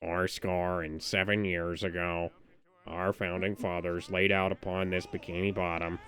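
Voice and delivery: low, flat, droning Squidward voice; monotone Squidward. Pretend. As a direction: drone the speech like a bored squid. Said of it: "You sound like a monotone Squidward".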